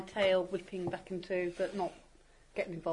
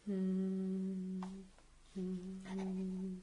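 soft humming; 11 dB quieter than usual. Pretend. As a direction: hum quietly as if meditating. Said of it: "Why is she humming?".

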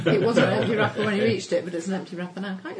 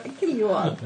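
put-on comedy Mexican accent. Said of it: "in the comedy Mexican accent".

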